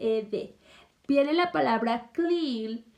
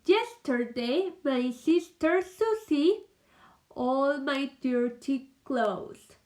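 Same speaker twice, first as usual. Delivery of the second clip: slow dictation reading; much slower than usual. Shown as a demonstration. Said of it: "en la siguiente oración dice".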